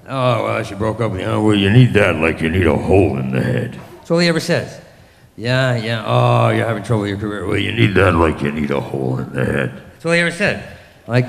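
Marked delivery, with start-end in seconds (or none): 0.1-3.8 put-on low, droning older-dad voice. Older dad consoling his son over a breakup, low droning brush-off. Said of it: "Finally, he's like". 5.4-9.7 low monotone of an aging father; older dad. Retold or imitated. Older dad hearing career troubles, low droning brush-off. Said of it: "Finally, he's like".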